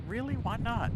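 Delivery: a mock-surprised high "Really? Why not?"; surprised question. Husband, with mock surprise, asking his wife why she won't fly. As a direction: feign disbelief, pitch jumping up. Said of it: "I'm like".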